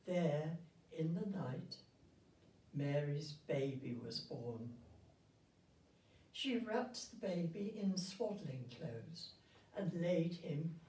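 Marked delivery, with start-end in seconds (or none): none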